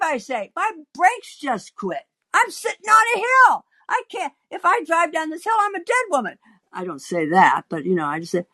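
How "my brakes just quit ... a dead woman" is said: high-pitched, hammed-up desperate protest; mock-panicked appeal; pleading. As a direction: beg him to understand, voice climbing high. Pleading with the man.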